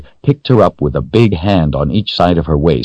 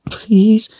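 put-on much higher, whispered child's whine; whined whisper. A narrator's reading. Frightened girl whining to be left alone, whispered whine. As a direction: plead in a thin breathy whine. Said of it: "she whined".

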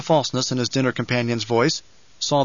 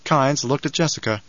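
ordinary delivery, nothing marked

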